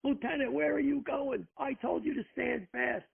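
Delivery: retold chief's yell, pitched high and drawn out; mock yell. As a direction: call after him high and insistent, slowly. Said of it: "the chief is yelling to".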